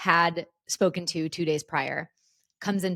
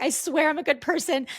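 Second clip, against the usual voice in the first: pleading; 9 semitones higher than usual. Retold or imitated. Pleading with nurse.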